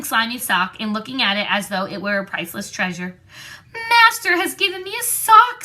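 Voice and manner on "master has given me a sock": high, squeaky house-elf, awed; a tiny elf marvelling at his gift; elf in wonderment. A narrator's reading. Dobby the house-elf, holding up the sock, awed and squeaky. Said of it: "said the elf in wonderment".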